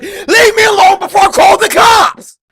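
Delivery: very loud, high-pitched yell; full-force panicked yelling; desperate shout. Acted. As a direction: scream a threat to drive someone off. Threatening the clown in the story.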